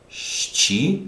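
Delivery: Щеколотка read haltingly, each syllable set apart. Shown as a demonstration. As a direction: sound out Щеколотка piece by piece for a learner. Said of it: "let's see if you can read it".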